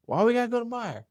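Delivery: very high mock mom voice; high-pitched acted-out question. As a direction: imitate a mother's puzzled protest.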